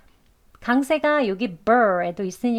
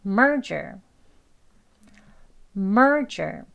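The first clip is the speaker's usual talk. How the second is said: "merger" with strong MER, relaxed "jer"; stress on first syllable; much slower than usual. Shown as a demonstration. Teacher giving two models to copy, "merger" front-stressed. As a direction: push MER in "merger", then let go. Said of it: "힘 빼고 하시면".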